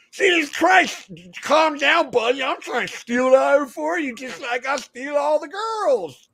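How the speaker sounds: high, slow, shouted swagger; Mad Dog voice. Retold or imitated. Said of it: "Mad Dog's all".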